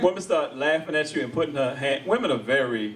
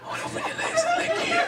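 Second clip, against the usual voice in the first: squealed high through laughter; laughing. Acted. Comic plays office harasser, shrieking with laughter. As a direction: blurt it cracking up.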